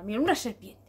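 usual speaking voice

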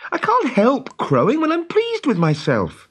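put-on high, loud boyish excuse; apologetic boy, high and urgent; pleading. A narrator's reading. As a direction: plead your case earnestly. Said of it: "pleaded Peter".

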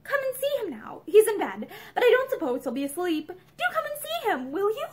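eager, high-pitched coaxing; lightly acted eager invitation; childish eagerness. A narrator's reading. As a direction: plead with a touch of childlike eagerness. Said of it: "With childish eagerness".

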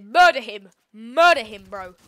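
loud urgent command; panicking gamer. Real, not acted. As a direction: bark it at your teammate. Urging teammate in fight.

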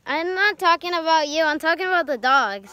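loud, high correction; calling out. Real, not acted. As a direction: shout it across the yard. Loud correction across yard.

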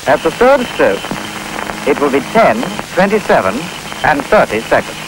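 choppy recorded clock voice; pieced-together speaking clock; spliced together words. Real, not acted. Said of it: "The complete announcement is broken into sections".

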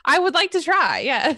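high, loud and eager; eager excited. Real, not acted. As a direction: jump at the chance.

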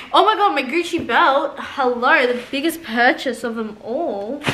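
high, loud gush of delight; excited gasp. Real, not acted. Showing viewers her long-awaited Gucci belt, high thrilled gush. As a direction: gush like you finally got it.

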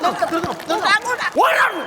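high-pitched shouts to stop; exasperated shout. Acted. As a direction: yell to cut off the noisy act, rising. Host stops noisy horse act. (ദേഷ്യത്തോടെ നിർത്താൻ അലറുന്നു)

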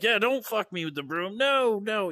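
high-pitched fake pleading; mock protest. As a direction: plead not to, badly faking it. Acts out feigned protest to bullies.

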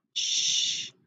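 whispered "sh", a long hush with no vowel; isolated "sh" phoneme; sh sound. Shown as a demonstration. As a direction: hush out "sh" like shushing. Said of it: "digraph S H".

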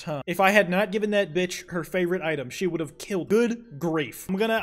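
ordinary delivery

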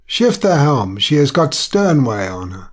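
high, loud, sharp scolding; loud sharp telling-off of a dozing helmsman; sharp hoarse rebuke. A narrator's reading. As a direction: snap him awake with a harsh reprimand. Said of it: "said sharply in a hoarse voice".

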